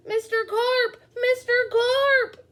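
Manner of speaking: loud high calling out; yelled. Acted. Boy calling for Mister Carp in the pool, loud high yell. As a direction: shout his name into the water. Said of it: "I yelled".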